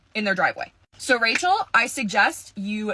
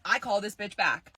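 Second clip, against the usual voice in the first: ordinary talk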